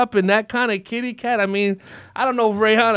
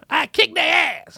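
high shouted granny threat; old grandmother impression; 9 semitones higher than usual. Grandmother repeating her threat to the host, high shout. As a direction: bark it like a tough old grandma. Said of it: "my old grandmother impression of a voice".

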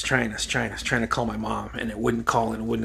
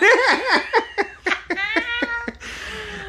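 high, long burst of giggling; real high-pitched laughing fit; helpless laughter. Real, not acted. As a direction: crack up at your own joke. Cracks up at own mom impression.

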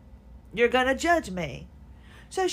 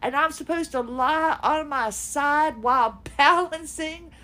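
normal talking voice

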